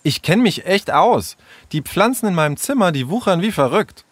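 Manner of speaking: huffy. A narrator's reading. Sarah defending her plant knowledge to Tom, huffy. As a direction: protest with a touch of pique. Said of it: "rief Sarah eingeschnappt".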